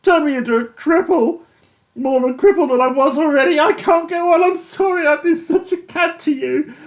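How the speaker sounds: high, loud tearful anguish; a broken man begging to go home; weeping despair. A narrator's reading. Yates weeping confession.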